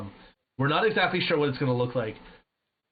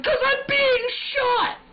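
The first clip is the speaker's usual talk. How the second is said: much higher, louder, slowed indignant yell; retold scream; 6 dB louder, slower than usual. Answering his wife who says he is screaming, indignant yell. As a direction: scream it back in outrage. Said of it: "You're screaming".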